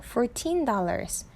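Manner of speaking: put-on fourteen said slowly with the weight on teen; teacher stressing teen in fourteen for students. Shown as a demonstration. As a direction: lean on the teen in fourteen, slow and clear. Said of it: "강세가 있는 거예요".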